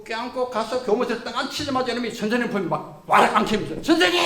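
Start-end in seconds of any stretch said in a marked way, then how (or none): 3.8-4.2 high, loud pleading call; a mock-tearful student throwing himself at his teacher; tearful cry out. Student runs crying to teacher.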